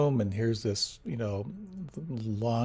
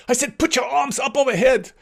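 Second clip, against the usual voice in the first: much higher, louder stern order; stern scolding; 13 semitones higher, 7 dB louder than usual. Retold or imitated. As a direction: scold the class for lowering their arms. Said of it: "She goes like".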